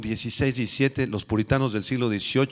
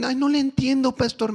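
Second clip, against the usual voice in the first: whiny youth; 11 semitones higher than usual. Retold or imitated. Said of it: "me dijo un día un jovencito".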